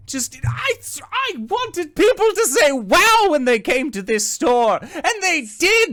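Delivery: high, laughing-crying voice, slowing; tearful lament. Acted. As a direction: cry over the lost dream. Breaking down over his store.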